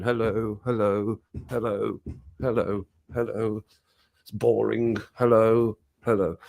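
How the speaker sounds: low, repetitive round of hellos; bored greetings in procession. Retold or imitated. Royals in the bumpy carriage greeting crowds, bored mimicry. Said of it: "going along like that, going".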